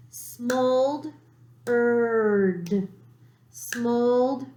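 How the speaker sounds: Smoldered said slowly, beat by beat; clapped syllables. Shown as a demonstration. Teacher claps the word with the class, slow and beat by beat. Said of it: "let's clap this".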